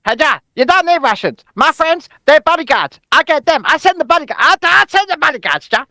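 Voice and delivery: high, loud comic voice; put-on character. Character offering bodyguards instead of Russians, high insistent comic voice.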